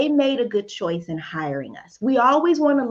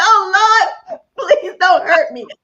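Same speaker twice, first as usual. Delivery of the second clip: high, loud, hammed-up plea for mercy; mock fearful plea; 9 semitones higher, 7 dB louder than usual. Teasing herself for questioning God, mock frightened plea. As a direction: beg Lord in mock terror, half crying.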